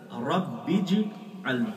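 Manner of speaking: very slow رب اجعلنی, ba running into jim; correct Arabic pronunciation. Shown as a demonstration. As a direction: model the proper رب اجعلنی. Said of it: "درمیان سے حمزہ گرتا ہے". (رب اجعلنی صحیح، بے کو جیم سے ملا کر)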